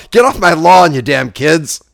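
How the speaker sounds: put-on loud grumpy old man yell; Clint Eastwood impression. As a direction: bark it like an angry old man. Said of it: "trying to do Clint Eastwood in Gran Torino".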